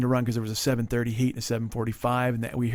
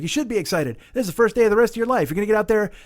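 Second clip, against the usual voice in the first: high, driving mock pep talk; enthusiastic pep talk; 6 semitones higher, 3 dB louder than usual. Stranger pumps up a first-time racer, enthusiastic. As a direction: gush like an over-positive trainer. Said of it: "He's all enthusiastic and inspired".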